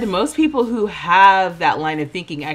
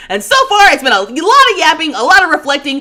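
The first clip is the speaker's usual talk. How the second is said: playful mock rant; 8 semitones higher, 6 dB louder than usual. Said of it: "respectfully, stop holding me accountable".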